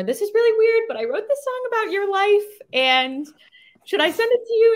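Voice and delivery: acting out an excited text; excited quote. Quoting her own text to Stella.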